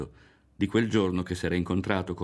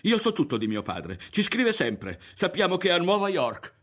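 high, loud, insistent protest; heated, pitched-up denial; overwrought; 10 semitones higher, 5 dB louder than usual. A narrator's reading. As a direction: insist as if swept away. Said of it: "una ventata lo sconvolse".

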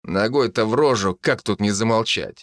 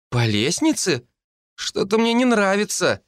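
put-on high, worried child's voice; nervous young girl; uneasy little girl; 10 semitones higher than usual. A narrator's reading.

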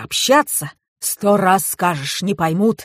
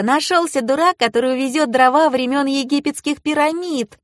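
put-on high-pitched gleeful kid; delighted girl; 7 semitones higher than usual. A narrator's reading. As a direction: crow in a child's high voice. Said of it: "обрадовалась девочка".

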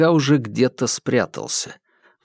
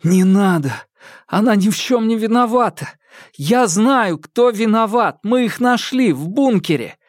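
much higher, louder near-tearful plea; desperate pleading; 9 semitones higher, 3 dB louder than usual. A narrator's reading. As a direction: beg him to stop, close to tears. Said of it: "К глазам подступили слёзы".

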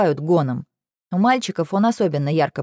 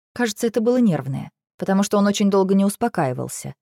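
usual speaking voice

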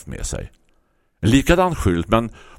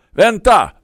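much higher, louder call; 14 semitones higher, 7 dB louder than usual. Acted. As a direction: stop him with a shout. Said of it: "ropade jag".

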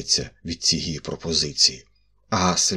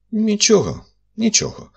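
much higher, softened reassurance; much kinder voice; 4 dB louder than usual. A narrator's reading. Magistrate kindly forgiving the embarrassed narrator. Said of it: "набагато добрішим голосом проговорив слідчий".

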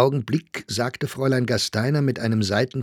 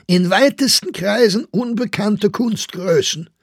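high, loud, indignant read-out; shouted quotation; 11 semitones higher, 6 dB louder than usual. A narrator's reading. Staufner quotes the review back at the actress, shouting. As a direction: shout the insulting phrase back. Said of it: "schrie Staufner".

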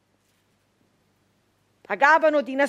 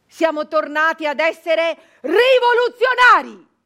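proud declaration; 4 semitones higher, 6 dB louder than usual. Acted. Proud triumphant claim.

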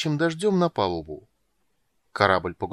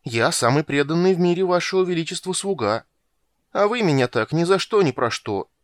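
high, louder wounded protest; sulky; 8 semitones higher, 5 dB louder than usual. A narrator's reading. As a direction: plead injured loyalty to the king. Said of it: "надулся Крысюк". (обиженно оправдываясь)

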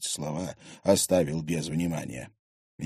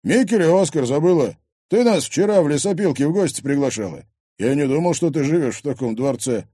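loud, higher brash reminder; pushy thug; 6 semitones higher, 6 dB louder than usual. Acted. Thug insisting girl invited them, pushy. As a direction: remind her with rough insistence. Thug's character voice.

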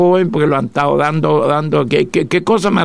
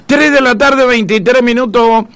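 announcer time check; 3 semitones higher, slower than usual. Acted. Radio time check and station ID.

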